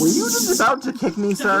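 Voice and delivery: high-pitched affronted question; mock indignation. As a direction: challenge the offender, voice leaping up. Affronted at being kicked.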